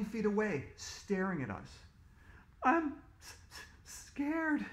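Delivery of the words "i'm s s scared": put-on high, stuttering frightened girl; stammering scared. A narrator's reading. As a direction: stammer it out in fear. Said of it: "who had a reason to be scared".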